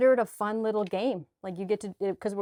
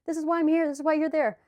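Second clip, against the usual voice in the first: much higher, louder joyful inner voice; lightly voiced beaming higher self; delighted higher self; 8 semitones higher, 5 dB louder than usual. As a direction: gush like a thrilled higher self who loves the challenge. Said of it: "My higher self was probably like".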